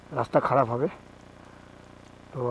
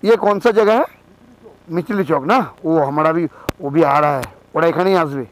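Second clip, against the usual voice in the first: loud asking across the road; calling out; 3 semitones higher, 7 dB louder than usual. Real, not acted. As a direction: call out to someone nearby. Calling to locals at stop.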